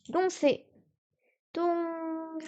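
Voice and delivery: dong held high and level; first tone. Shown as a demonstration. Teaching French learners, demo of dong in first tone. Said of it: "dong premier ton".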